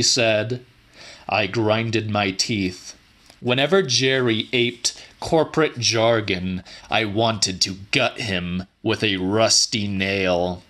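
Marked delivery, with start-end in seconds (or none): none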